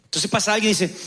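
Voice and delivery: usual speaking voice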